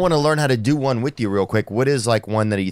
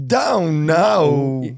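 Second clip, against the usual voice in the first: copycat stretched, high syllables; Christopher Walken; 5 semitones higher, 3 dB louder than usual. Retold or imitated. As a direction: echo the odd baffled cadence. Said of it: "Christopher Walken sees an amazing magic trick".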